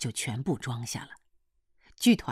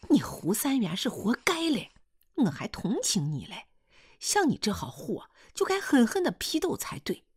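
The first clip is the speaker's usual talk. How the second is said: high-pitched angry woman's tirade in dialect; scolding rant, pitched well above narration; 9 semitones higher than usual. A narrator's reading. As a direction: lash out with escalating scorn. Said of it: "就听胡彩香又乱嚼乱骂起来".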